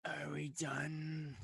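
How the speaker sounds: weary rasp, soft and wheezy; rasping, wheezing creature. Acted. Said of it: "rasping, wheezing voice".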